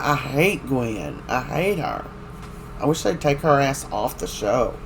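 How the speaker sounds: usual speaking voice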